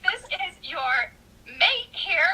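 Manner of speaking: scolding. Retold or imitated. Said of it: "I'm like".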